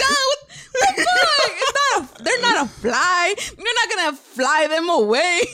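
high, laughing mock shout; laughing mock yell. Yelling at brother John, high laughing mock shout. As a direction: yell at him while cracking up. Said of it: "I was like".

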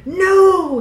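loud, high, drawn-out cry; bellow. A narrator's reading. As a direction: bellow it in protest. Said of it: "he bellowed".

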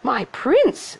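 put-on high, girlish lament; high-pitched dismay; dismayed Cindy. A narrator's reading. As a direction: think it aloud in a high voice. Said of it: "she thought".

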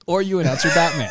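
cracking up mid-line; laughing repeat of the Batman joke. Real, not acted. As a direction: shout it through laughter.

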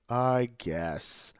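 reluctant mock agreement. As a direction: agree with zero enthusiasm. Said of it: "That exact tone".

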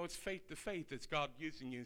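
usual speaking voice

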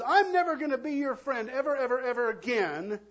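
hammed-up angry preschooler shout; childish yell. Preacher imitating a mad preschooler yelling at a friend. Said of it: "one preschooler yells at another".

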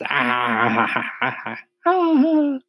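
acted group laughter climbing high; roar of laughter. A narrator's reading. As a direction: laugh long, rising to a high cackle. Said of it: "a roar of laughter from all sides".

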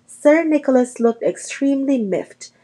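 plain reading voice

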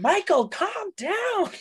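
high, drawn-out mocking mom voice; high, slow, mock-gentle mother. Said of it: "grabbing him by the shoulders and shaking him".

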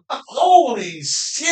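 slow, high amazed exclamation. Retold or imitated. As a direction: draw it out in disbelief. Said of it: "I'm like".

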